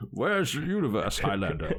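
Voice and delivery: mock Scottish film-star impression; Sean Connery. Co-host topping the failed accent, mock Highlander star impression. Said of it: "That was more Sean Connery".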